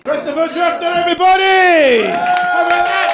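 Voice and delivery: big shout to the crowd, pitched high; rally the room; loud compere call. Real, not acted.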